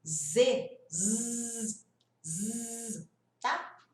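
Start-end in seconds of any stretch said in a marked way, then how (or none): 0.9-3.1 long buzzing zzz; buzzing z sound. Shown as a demonstration. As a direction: hold a steady zzz buzz. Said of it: "sabe aquele som de Z". (zumbido longo de z)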